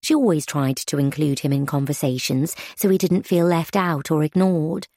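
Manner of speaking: ordinary delivery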